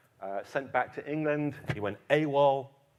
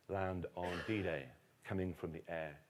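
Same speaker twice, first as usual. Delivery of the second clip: usual speaking voice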